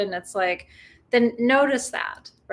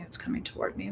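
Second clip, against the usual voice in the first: put-on a soft, whispered reply in the child's part; whispered child answer; 10 dB quieter than usual.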